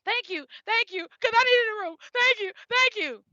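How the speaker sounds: sobbed gratitude, relived; tearful thanks. Retold or imitated. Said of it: "And I was just crying".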